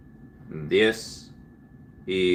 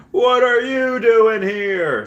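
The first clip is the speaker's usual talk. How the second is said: much higher, loud and very slow, dragged-out cartoon whine; BoJack Horseman impression; 6 dB louder, much slower than usual. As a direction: stretch every word like a flustered cartoon horse. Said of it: "he does that BoJack Horseman".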